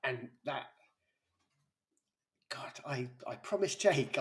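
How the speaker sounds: real tears close, voice straining to hold together; fighting back tears while speaking of him; genuinely choked up, pushing through the words; emotional. Real, not acted. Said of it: "I said I wasn't gonna get emotional".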